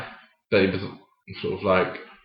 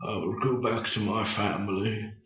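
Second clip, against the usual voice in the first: put-on very soft, fading elderly voice; old man; 13 dB quieter than usual. Actor recalling his old-man role, very quiet. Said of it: "another time being an old man".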